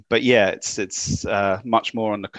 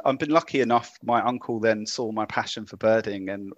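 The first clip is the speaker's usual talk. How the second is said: ordinary talk, nothing marked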